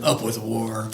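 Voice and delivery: low, restrained war chant; mock dinosaur shout. A narrator's reading. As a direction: chant it low like a mean tyrannosaurus. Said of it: "And they shouted".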